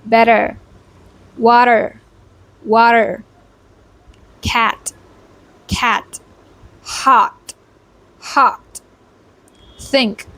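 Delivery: isolated words in an American accent; American pronunciation practice, word by word; American accent drill. Shown as a demonstration. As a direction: read each word out slowly in American style. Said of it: "let's go try reading out these words".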